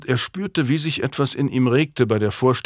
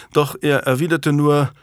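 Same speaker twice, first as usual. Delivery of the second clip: plain reading voice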